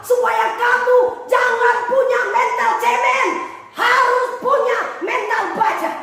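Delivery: a hint of a chiding, amused God; lightly voiced God.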